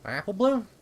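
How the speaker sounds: a high, unsure question; stunned disbelief. Acted. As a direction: ask as if seeing a ghost. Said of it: "spoke as if she'd never seen her before".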